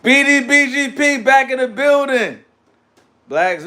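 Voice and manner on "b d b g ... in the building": hype shout-out. Real, not acted.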